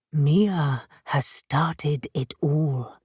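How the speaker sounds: slow, low and otherworldly. A narrator's reading. Hades warns Birdie, slow and low. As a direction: drag it out like an ancient spirit. Hades the spirit cat speaks.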